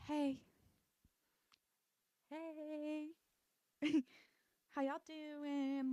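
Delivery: high, drawn-out mock-smitten hello; mock flirty greeting. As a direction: stretch the greeting out, hammed-up smitten. Voicing a smitten character's flirty greeting.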